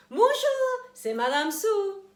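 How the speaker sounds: high, loud cheerful hello; bright intro. Acted. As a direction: greet the kids with big energy. Teacher introduces herself to children.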